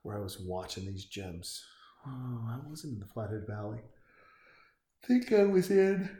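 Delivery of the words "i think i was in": talking mid-yawn; words stretched by a yawn. Real, not acted. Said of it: "pardon my yawn".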